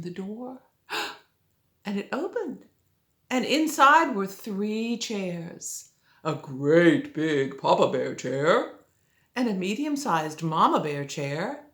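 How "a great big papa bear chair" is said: plain reading voice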